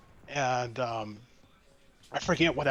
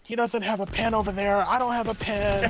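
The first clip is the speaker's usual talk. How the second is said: angry customer demanding a pen, raised and high; furious customer; 7 semitones higher, faster than usual. Retold or imitated. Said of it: "he's so mad".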